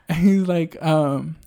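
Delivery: usual speaking voice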